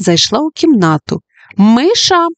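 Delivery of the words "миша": high, loud surprised cry; exclaiming. Acted. Judy cries out at the mouse entering, surprised. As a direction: yelp the name in mock surprise. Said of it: "вигукнула Джуді".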